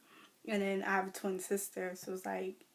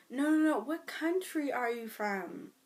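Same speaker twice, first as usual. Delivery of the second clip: pushy manager; 5 semitones higher, 3 dB louder than usual. Retold or imitated. Pushy manager questioning her.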